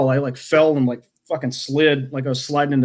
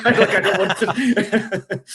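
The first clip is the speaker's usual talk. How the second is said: higher, drawn-out words breaking into laughs; laughing; 7 semitones higher, much slower than usual. Real, not acted. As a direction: talk through laughter. Joking while laughing along.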